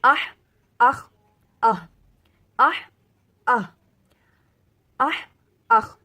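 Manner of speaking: آہ breathy, آخ rasped, loud and separate; throat letter practice. Shown as a demonstration. Teacher leading throat letter practice for students, loud. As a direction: drill them one by one. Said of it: "دونوں کو اکٹھے... practice کریں گے".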